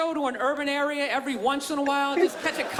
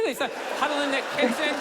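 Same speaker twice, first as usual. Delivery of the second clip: ordinary talk, nothing marked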